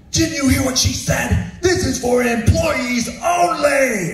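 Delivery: imitating a high-pitched furious yell; hammed-up outraged shouting, words stretched out; angry yelling. Irate lunchroom employee confronts the storyteller, yelling. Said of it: "he's yelling at me".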